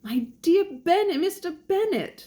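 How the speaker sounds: high, fussy British lady calling her husband; British wife. Acted. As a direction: pitch it way up, loud British matron. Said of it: "said his lady to him".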